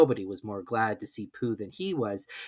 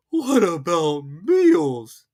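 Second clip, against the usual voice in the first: glum Pooh; 6 semitones higher, 8 dB louder, much slower than usual. A narrator's reading. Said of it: "said Pooh glumly".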